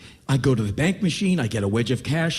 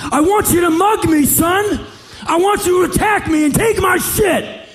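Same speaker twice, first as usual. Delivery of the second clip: high, loud, slowed shouting demand; furious rant; 6 dB louder than usual. Retold or imitated. Demanding the boy attack and rob him, roaring high and loud. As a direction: bellow the challenge, hammering each word.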